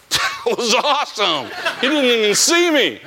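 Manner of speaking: giddy chuckling brag; laughing. Retold or imitated. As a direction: relive the hit with a delighted laugh. Gleeful retelling of a big hit.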